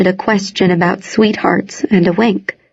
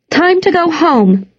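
calling out; 8 semitones higher than usual. A narrator's reading. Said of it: "she called".

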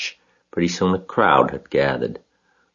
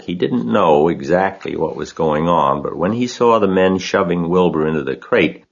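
ordinary delivery

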